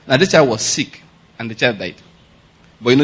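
ordinary talk

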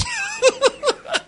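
high-pitched burst of laughter; short, high laugh; real laughter. Real, not acted. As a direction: crack up at your own punchline. Laughing after the punchline.

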